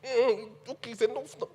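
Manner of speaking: a tight, fed-up internal "that's enough"; jealous inner thought. Retold or imitated. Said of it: "thinking to myself".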